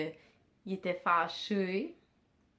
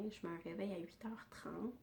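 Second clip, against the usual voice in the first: whispered; 13 dB quieter than usual. Real, not acted. Whispered aside.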